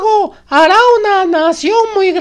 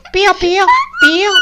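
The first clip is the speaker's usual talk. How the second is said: high squeaky chick peeps; faster than usual. Shown as a demonstration. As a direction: peep like a tiny chick. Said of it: "Ahora con voz de pollito". (voz de pollito)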